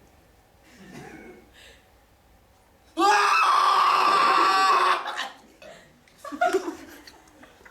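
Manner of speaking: long, very loud high scream. Acted. Married man letting out his inner misery, long scream. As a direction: let out a huge sustained scream. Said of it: "I was screaming".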